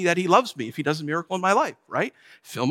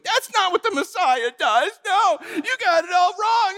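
high, whining mock-crying complaint; whiny Pharisees; 14 semitones higher than usual. Preacher mocks Pharisees as whining children, high and mock-crying.

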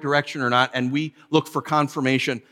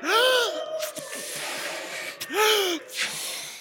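big mock gasp; 5 dB quieter than usual.

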